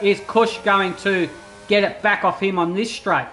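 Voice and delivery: ordinary delivery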